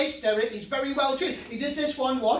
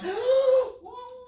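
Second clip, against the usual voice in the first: mock gasp; 12 semitones higher than usual. Pretend panic over puppet.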